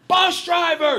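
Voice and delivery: high, loud call to the driver; megaphone shout. Retold or imitated. TV host calling out to the bus driver, loud shout. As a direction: bark it through a megaphone. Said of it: "and he goes".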